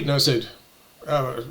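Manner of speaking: ordinary talk, nothing marked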